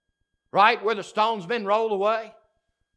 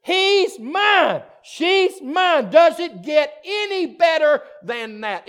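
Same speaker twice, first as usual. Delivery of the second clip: much higher, shouted joy; excited climax; 7 semitones higher, 7 dB louder than usual. Acted. Pastor exulting to the congregation, high joyful shout.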